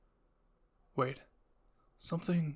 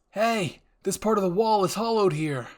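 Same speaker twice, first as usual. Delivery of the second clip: higher, louder soldier's call; 5 semitones higher, 7 dB louder than usual. A narrator's reading. Soldier calling out to the squad, loud. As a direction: shout the find to the others. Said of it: "one soldier called out".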